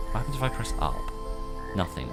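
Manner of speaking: ordinary talk, nothing marked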